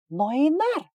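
high, loud hysterical cry. A narrator's reading. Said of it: "истерично перебила его Далия".

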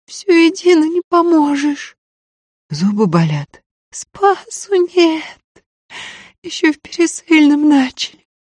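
high, pained voice through tears; girl with toothache. Acted. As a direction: whimper it, aching jaw, near crying. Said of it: "Девка едва говорила сквозь слёзы".

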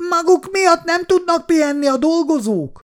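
high-pitched threatening neighbour woman; angry neighbour. A narrator's reading. Neighbour woman threatens Szabó bácsi, high and angry.

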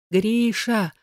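high sobbed name; sobbing cry on the edge of euphoria. A narrator's reading. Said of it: "всхлипнула".

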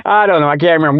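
normal talking voice

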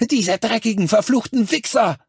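high, shrill shouted curse; shrill cursing. A narrator's reading. Lucky curses the killers of the aliens, shrill furious shout. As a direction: screech the curse out in fury. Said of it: "rief mit schriller Stimme".

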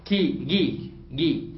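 كي with k, then جي with a hard g; k to g shift. Shown as a demonstration. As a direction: show كي turning into جي. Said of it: "فخلوها إيه؟".

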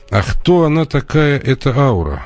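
to someone hard of hearing. Real, not acted. Caller can't hear.

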